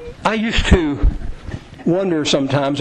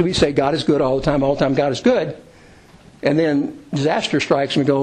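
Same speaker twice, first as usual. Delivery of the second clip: ordinary delivery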